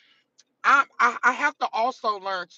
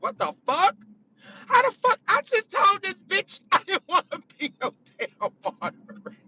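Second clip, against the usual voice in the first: Casey's indignant voice; 11 semitones higher than usual. Acted. Streamer imagining an unwilling moderator's reaction, laughing mock outrage. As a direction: squeal the complaint, half laughing. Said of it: "I can hear Casey's voice like".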